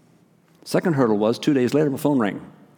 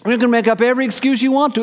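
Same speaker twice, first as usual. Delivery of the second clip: lively preaching build; 8 semitones higher, faster than usual. Real, not acted. Preacher building his point.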